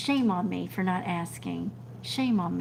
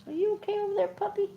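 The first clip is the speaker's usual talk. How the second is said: dog talk; 13 semitones higher, much faster than usual. Real, not acted. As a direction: coo at the dog sweetly. Talking to her dog.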